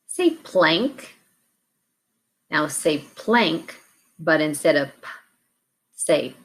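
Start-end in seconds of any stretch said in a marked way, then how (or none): 5.0-5.1 p as a bare puff, not "pee"; letter sound. Shown as a demonstration. Teacher gives a sound to learners, p as a puff. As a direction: say just the popped sound of p. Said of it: "what sound to change".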